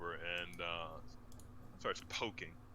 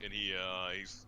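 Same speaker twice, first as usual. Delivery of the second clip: usual speaking voice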